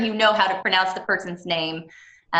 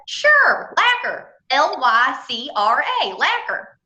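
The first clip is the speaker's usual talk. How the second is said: put-on Southern accent, pitched far higher and loud; thick Southern accent; 6 semitones higher, 5 dB louder, much slower than usual. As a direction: spell it out in Ken's Southern accent, way up high. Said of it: "this real thick, wonderful Southern accent".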